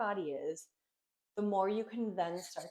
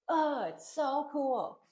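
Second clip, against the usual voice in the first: excited; 7 semitones higher, 4 dB louder, much slower than usual. Real, not acted. Teacher delighted by yoga idea.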